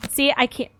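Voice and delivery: normal talking voice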